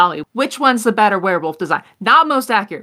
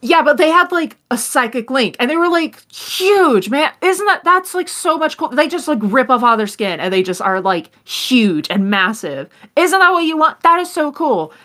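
a higher, excited fanboy gushing about werewolves; an overheard fan raving, pitched up and eager; excited male voice; 7 semitones higher than usual. Acted. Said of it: "a male voice saying".

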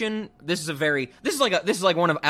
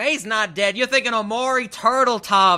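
higher, louder bickering wife; mock old wife; 5 semitones higher, 5 dB louder than usual. Podcaster voicing the wife correcting her husband, higher bickering tone. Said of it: "And she's like".

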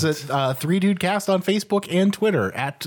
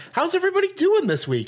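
much higher, bubbly greeting; perky influencer hello to the feed; hammed-up cheerful host; chipper host voice; 13 semitones higher than usual. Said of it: "Create post. All right".

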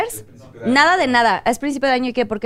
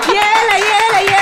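loud higher vendor cry; hyped fairground barker; 7 semitones higher, 5 dB louder, slower than usual. Pretend. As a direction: yell like an ice-cream seller.

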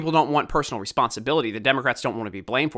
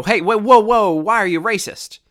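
mock outrage; 6 semitones higher, 6 dB louder than usual. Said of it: "And I go".

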